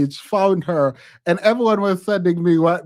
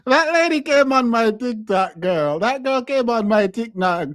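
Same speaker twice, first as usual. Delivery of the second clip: ordinary delivery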